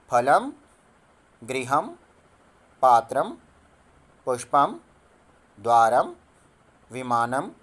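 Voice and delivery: each word said separately and clearly, with a pause after every word; word by word for students to echo; say along clearly. Shown as a demonstration. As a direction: read each word out for the class to repeat. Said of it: "तो साथ साथ बोलिए".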